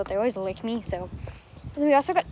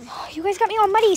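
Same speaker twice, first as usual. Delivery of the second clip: exasperated scolding; 7 semitones higher, 4 dB louder than usual. Real, not acted. Dogs jumping and muddying her.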